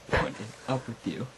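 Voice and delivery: normal talking voice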